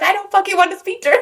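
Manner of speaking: high, fast mock protest; retold exasperation. Labouring mother refusing a phone call, exasperated.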